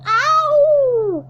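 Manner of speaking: very high, loud yelp; sharp ow of pain. Acted. Elephant bitten by the crocodile, loud yelp. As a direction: yelp as the teeth bite.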